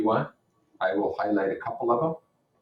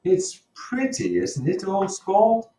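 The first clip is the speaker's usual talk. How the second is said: much higher, slow, proud Gatsby voice; Gatsby boasting to Nick about his car, high and slow; Gatsby showing off; 11 semitones higher, 5 dB louder, slower than usual. Acted. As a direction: show off the car, stretched out and high. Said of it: "He saw me looking with admiration at his car".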